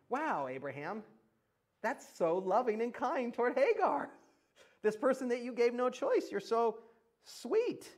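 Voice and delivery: high, syrupy mock admiration; sarcastic praise. Sarcastic praise of Abraham's callousness.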